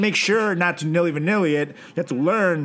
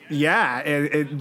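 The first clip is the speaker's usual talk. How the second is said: normal talking voice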